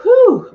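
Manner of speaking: high loud breathy whew; overcome whew. Real, not acted. Medium overwhelmed by the daughter's message, high whew.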